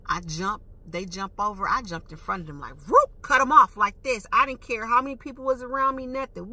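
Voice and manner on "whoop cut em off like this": loud, high swerving whoop; imitated swerve. Driver showing how she jumped in front of them, loud swooping imitation. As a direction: punch it out loud like a car swerving. Acts out swerving car cut-off.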